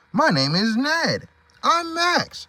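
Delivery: high, soft, slow introduction; high put-on voice. Zebra and donkey introduce themselves, high soft voice. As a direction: introduce yourself gently.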